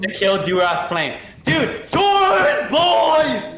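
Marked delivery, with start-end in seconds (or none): 1.4-3.5 much higher, louder, slow stretched cheer; excited shout to the group; hype shout. Real, not acted. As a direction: yell the name like a rallying cry. Hyping up the party name.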